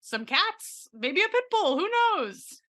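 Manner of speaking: rising excitement. Pretend. Escalating joking offer.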